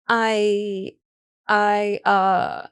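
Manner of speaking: drawn-out, halting false starts; stuck for words, level pitch; hesitant stammer. A narrator's reading. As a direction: stall while searching for an answer. Lost for an answer when questioned.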